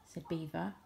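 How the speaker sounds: usual speaking voice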